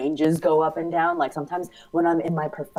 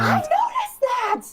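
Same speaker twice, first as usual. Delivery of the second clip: high-pitched burst of recognition; much higher, drawn-out exclamation; excited interjection; 4 dB louder, slower than usual. Real, not acted. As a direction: squeal it out, delighted to have spotted it. Excited interjection on speaker's habit.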